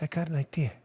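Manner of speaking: lightly voiced mobster rasp, low and soft; Vito Corleone. Host mocks a consultant as a mobster, lightly voiced godfather. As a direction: mumble it like an old mafia boss. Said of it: "you got your Vito Corleone voice?".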